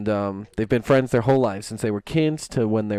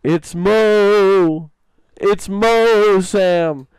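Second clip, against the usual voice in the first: higher, louder ghostly voice; 8 semitones higher, 6 dB louder, much slower than usual. Retold or imitated. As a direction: answer like a spirit from beyond. Ghost answers in the joke.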